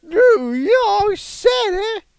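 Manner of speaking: high, slow, strained voice; strange impression. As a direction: stretch every word out oddly. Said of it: "a strange impression".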